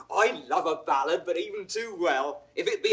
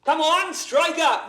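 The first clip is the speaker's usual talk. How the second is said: higher, louder call to the band, drawn out slowly; 9 semitones higher, 4 dB louder, slower than usual. Acted. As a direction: strike up the music with a hearty call. Calls the musicians to play.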